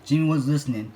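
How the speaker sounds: ordinary delivery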